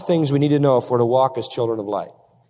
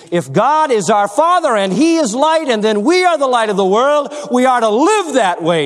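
higher, driving pulpit voice; emphatic pulpit proclamation; full-force preaching; 8 semitones higher, 4 dB louder than usual. Real, not acted. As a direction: preach it out, leaning on key words. Preacher builds to a peak.

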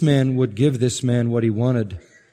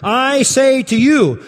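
much higher, louder and slow, ringing declaration; voice of divine authority; 11 semitones higher, 5 dB louder, slower than usual. Shown as a demonstration. Preacher voicing Jesus with absolute divine authority. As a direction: proclaim it like a king issuing a decree. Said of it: "this is the voice of absolute divine authority".

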